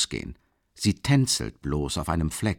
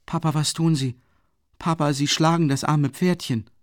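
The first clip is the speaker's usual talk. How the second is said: put-on higher pleading child voice; distressed child; 8 semitones higher than usual. A narrator's reading. Boy begging his father about the poor horse, distressed. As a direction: beg father to stop it.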